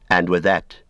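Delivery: ordinary delivery, nothing marked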